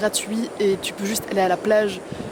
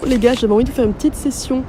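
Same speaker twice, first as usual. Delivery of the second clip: loud, projected voice for the phone; speaking up for phone mic; 7 dB louder than usual. Real, not acted. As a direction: project so the phone hears you. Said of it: "je sais pas du tout si vous allez entendre quelque chose".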